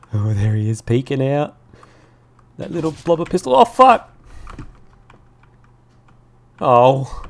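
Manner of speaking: high, loud and tense; excited shouting. Real, not acted. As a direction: yell in the heat of a firefight. Enemy peeking out in firefight.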